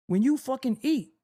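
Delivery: ordinary talk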